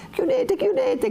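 put-on terrified girl crying out to shut the door; shouting. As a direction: scream for them to shut the door. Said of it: "gridava Lucia".

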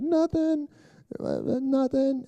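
high falsetto child impression, drawn out; mimicking young son. Son caught in the alley answers dad, mimicked high evasive voice. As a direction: imitate a boy caught hiding. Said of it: "He's like".

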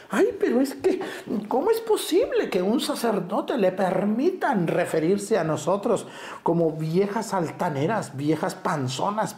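high, fussy indignant voice; mock offended lady. Priest parodying an offended woman in the pews. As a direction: play the scandalized parishioner, high and prissy.

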